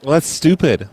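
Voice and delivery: ordinary talk, nothing marked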